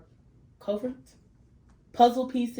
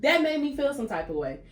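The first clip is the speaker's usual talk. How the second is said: raised-pitch protest at normal pace; indignant; 7 semitones higher than usual. Real, not acted. Woman venting about being judged, indignant restatement. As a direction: repeat it high but controlled.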